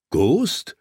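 high, rising shocked question. A narrator's reading. Lord hearing his wolf bit a guard, shocked question. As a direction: ask it in startled disbelief. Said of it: "John war schockiert".

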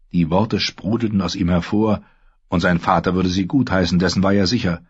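normal talking voice

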